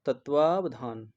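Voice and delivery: तत्वावधान slowly, stretching 'vaav'; slow correct pronunciation. Shown as a demonstration. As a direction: spell out तत्वावधान by ear, tat-vaaav-dhaan. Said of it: "शुद्ध रूप क्या हो जाएगा". (तत्वावधान, धीरे-धीरे, 'वाव' खींचकर)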